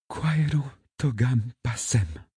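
quietly resolute, measured Latin; soft but firm. A narrator's reading. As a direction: answer gently, without wavering. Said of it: "dit-elle doucement, d'une voix ferme".

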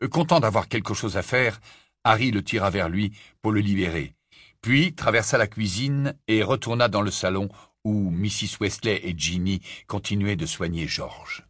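usual speaking voice

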